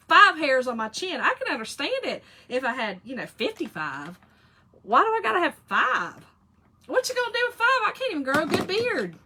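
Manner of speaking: loud, high, incredulous complaining; ranting about five chin hairs, voice climbing; exasperated rant. Real, not acted. To her viewers, an exasperated high rant about chin hairs.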